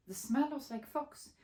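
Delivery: ordinary delivery, nothing marked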